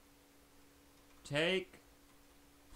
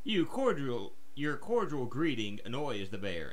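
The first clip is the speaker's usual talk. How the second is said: ordinary delivery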